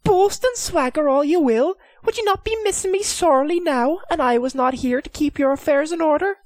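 high, teasing lady of the castle; Lady McSweeney's voice. Acted. The lady teasing her husband, high and playful.

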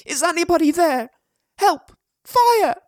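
high, loud, urgent shout; shouting for help. A narrator's reading. Boy lost by burning wreck calls for help, shouting. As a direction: call out loudly into emptiness. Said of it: "he shouted".

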